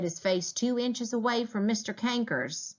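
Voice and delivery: ordinary delivery, nothing marked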